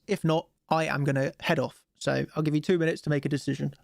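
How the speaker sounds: usual speaking voice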